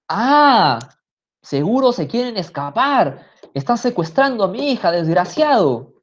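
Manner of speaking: high, furious accusing yell, slowing on the insult; angry shout. Acted. Mother accusing the boyfriend of kidnapping, shouting angrily. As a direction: hurl the accusation at him. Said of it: "le grita".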